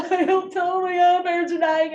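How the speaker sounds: mild tearful plea to the stranger; retold blubbering. Blubbering lost in the hallway.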